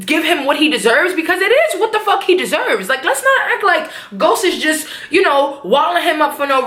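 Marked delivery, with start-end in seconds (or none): none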